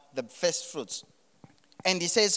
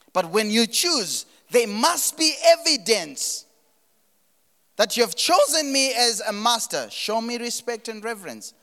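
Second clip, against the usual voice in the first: preaching; 5 semitones higher, 5 dB louder than usual. Acted. Preacher urging the church to honor God, emphatic. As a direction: drive it home to the congregation. Preacher stressing God's demand.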